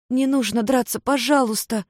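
lightly voiced pleading girl. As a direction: beg them to stop. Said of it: "взмолилась Майми".

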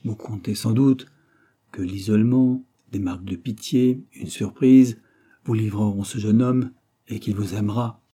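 ordinary delivery, nothing marked

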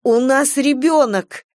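high, slow indignant cry; high-pitched angry protest; starting to seethe. A narrator's reading. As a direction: burst out in rising indignation. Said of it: "Кира начала закипать".

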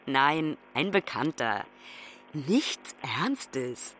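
low, soft and guarded; reticent admission. Acted. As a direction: play it down, voice dropping low and quiet. Daughter plays down a date.

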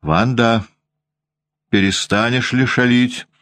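выше, строгий окрик на девочку; прикрикни строго, чуть выше голоса; окрик повыше. A narrator's reading. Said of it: "прикрикнула на неё и Анна Григорьевна". (воспитательница прикрикивает на шалунью, строго и выше)

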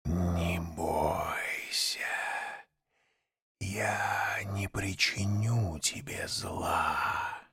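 slow breathy serpent whisper; hypnotic whisper. A narrator's reading. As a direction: lull him with a hushed hiss. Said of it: "Тихий, завораживающий шёпот".

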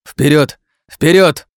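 loud, high shouts to charge; urgent command. Acted. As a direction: bellow the order to run, rising. Urging flight from creatures.

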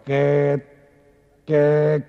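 comic talking cow with a stammer; stuttering cow. Acted. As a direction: stall on each syllable like a stammerer. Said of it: "Es que era tartamuda".

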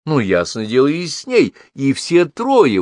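high, swooping accusatory exclamations; indignant mother, voice leaping up; exclaiming. A narrator's reading. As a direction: pile up the accusation with rising pitch. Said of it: "восклицала мама".

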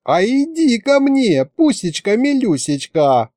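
put-on high, slow baby-talk coo; doting, stretched high-pitched endearments. A narrator's reading. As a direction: coax it sweetly like a pet. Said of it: "умилилась Маша".

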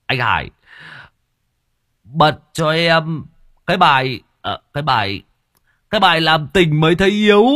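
high, loud slurred request; drunken shout. A narrator's reading. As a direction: call out the song, drunk.

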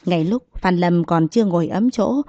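plain reading voice

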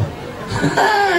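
giggling burst; high breathy giggle; high laugh. Real, not acted. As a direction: laugh high at your own joke. Laughs at own joke.

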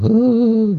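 high drawn-out fake sob; hammed-up crying howl; mock sobbing wail. Wife who only keeps crying to her husband, mock wail. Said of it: "Terus nangis, nangis saja".

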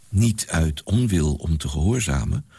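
ordinary delivery

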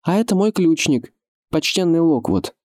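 high, carrying introduction; young lord introducing. A narrator's reading. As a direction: present a companion to those on the wall. Adam introduces companion, calling up.

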